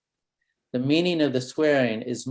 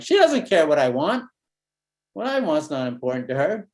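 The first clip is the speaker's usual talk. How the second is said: put-on a child complaining, louder at first; upset kid; 2 semitones higher, 4 dB louder than usual. Kid telling the therapist why he's angry, hurt. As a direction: play the hurt boy blurting it out. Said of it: "the kid will probably say something like".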